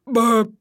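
short loud burp; bird burp. A narrator's reading. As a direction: punch out a quick burp. Full doves burp.

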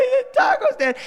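high crying wail with a laugh in it; imitating a sobbing child. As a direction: sob it out like a heartbroken kid. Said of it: "that nine-year-old sobbing".

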